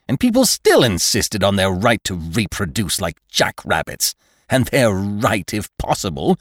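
loud, heated population lecture; severe doctor. A narrator's reading. Doctor lectures father on life before the law, severely. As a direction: rail at him, high and forceful to the last word.

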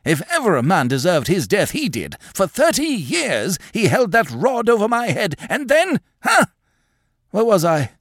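put-on high, rambling, feverish old man's voice; agitated old man. A narrator's reading. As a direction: rave at her like a haunted old man. Craven's agitated ravings.

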